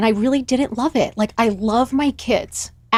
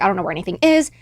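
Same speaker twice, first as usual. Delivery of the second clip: usual speaking voice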